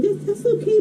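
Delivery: put-on high, soft comforting kid voice; consoling kid. Friend patting the crying boy, consoling. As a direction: imitate a kid soothing a pal. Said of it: "he's like".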